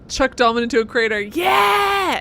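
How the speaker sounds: loud whoop of triumph; excited shout. Real, not acted. Streamer cheering at the game's order, excited shout. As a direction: yell it out, pumped up.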